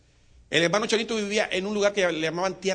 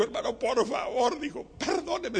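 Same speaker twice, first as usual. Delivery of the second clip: high plea; 7 semitones higher than usual. Acted. Chanito begs forgiveness.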